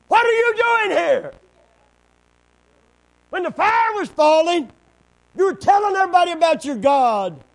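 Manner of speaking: very high, loud confrontation; shouted rebuke. Acted. God reminding Elijah of the fire falling, shouted rebuke. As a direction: holler it as an indignant challenge.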